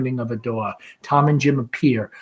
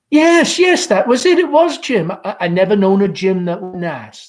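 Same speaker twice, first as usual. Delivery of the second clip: much higher, eager; bright chatter; 7 semitones higher, 7 dB louder than usual. Acted. Mother confirms the name to daughter, eager. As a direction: gush it out.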